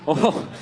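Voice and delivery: imitating uneasy passengers' laughter; nervous laughter. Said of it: "laughing nervously".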